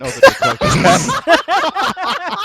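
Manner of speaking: laughing. Real, not acted. Group joking, speaker laughing.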